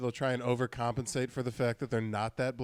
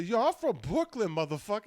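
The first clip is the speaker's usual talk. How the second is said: mock Brooklyn brag; 7 semitones higher than usual.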